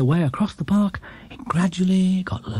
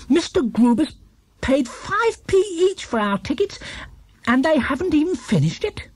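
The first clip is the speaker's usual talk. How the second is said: put-on much higher young bear voice, hotly outraged; a small bear feeling cheated; indignant Paddington; 12 semitones higher than usual. A narrator's reading. Paddington outraged about tickets.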